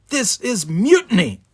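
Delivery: officer sharply denouncing the bridge crew; sharp outcry. A narrator's reading. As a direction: denounce it as mutiny. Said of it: "a voice cut in sharply from behind him".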